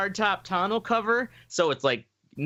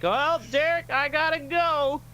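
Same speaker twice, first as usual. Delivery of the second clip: high, drawn-out put-on voice; mock panicky; 10 semitones higher than usual. Narrator telling Derek he must leave, high and drawn out. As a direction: squeal your exit, slowly. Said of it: "I'm like".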